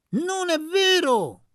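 very high, very loud, slow denial; accused landowner shouts down Luca's charge; furious shout. A narrator's reading. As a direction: hurl the denial at him. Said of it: "gridò Stefano Staniscia".

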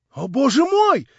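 high, loud rapturous exclamation; cry of delight. A narrator's reading. Young man overjoyed at her forgiveness, rapturous cry. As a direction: burst out with joy. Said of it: "вскрикнул он в восторге".

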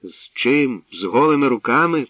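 loud, high scolding retort. A narrator's reading. Father scolds son going unarmed, sharp scolding. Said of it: "посварився Корінь".

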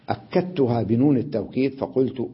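ordinary talk

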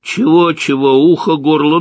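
high, loud taunting comeback; mocking retort. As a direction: throw it back loudly at the thug. Kostya taunts the thug while fleeing.

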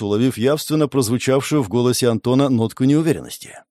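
plain reading voice

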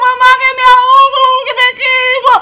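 high, loud panicked scream; desperate cry. Acted. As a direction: scream for help, drowning. Boy burning in pot.

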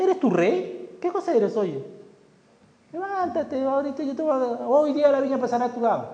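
high, mocking taunt; scolding wife. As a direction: scold him like a scornful queen. Jezebel scolding Ahab.

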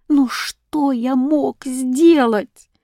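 slow, high, drawn-out despair; helpless exclamation. A narrator's reading. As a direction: ask it as a helpless appeal. Hero helpless before everyone's suffering.

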